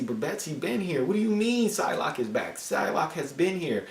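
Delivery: high-pitched incredulous protest; baffled objection. Acted. Reviewer disputing that Psylocke is back, baffled. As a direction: protest in disbelief, pitch climbing.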